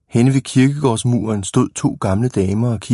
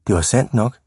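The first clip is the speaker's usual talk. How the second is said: ordinary delivery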